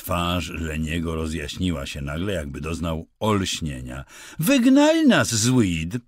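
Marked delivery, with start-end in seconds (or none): 4.4-6.0 high, loud triumphant cry; loud triumphant shout; triumphant exclamation. Acted. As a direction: blurt it out like a proud discovery. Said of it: "wykrzyknął triumfalnie".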